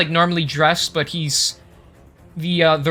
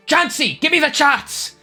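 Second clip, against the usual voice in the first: calling out; 11 semitones higher than usual. Acted. Leader calls for assistant.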